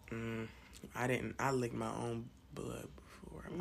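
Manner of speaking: hushed confession whisper. Real, not acted. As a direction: whisper it like a guilty secret. Confessing something gross.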